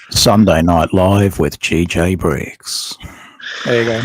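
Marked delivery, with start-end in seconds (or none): 0.1-3.0 deep, slow announcer voice; intro voice. Acted. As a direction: boom it out like a show intro. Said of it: "we need your intro voice".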